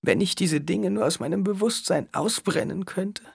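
high, anguished moan. A narrator's reading. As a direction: moan it in despair. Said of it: "stöhnte sie".

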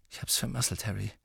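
soft, downcast confession; subdued and guilty; dejected. Acted. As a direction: own up quietly. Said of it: "sagte Ron bedrückt".

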